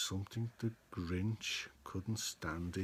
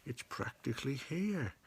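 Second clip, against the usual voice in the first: mild sneer; 3 semitones higher, 4 dB quieter than usual. Acted. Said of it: "he snarled with a sneer".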